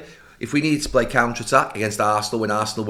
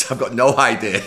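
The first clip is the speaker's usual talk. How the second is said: laughing reply, voice louder; laughing through it; 5 dB louder than usual. Real, not acted. Manager laughing at his own clueless answer to players. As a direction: giggle the words out.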